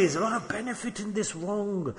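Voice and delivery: soft, coaxing undertone; sugarcoated persuasion. Acted. Shaitan presents wrong as benefit, in a soft coaxing voice. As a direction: drop the volume and tempt them gently. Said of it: "presents it to you nicely".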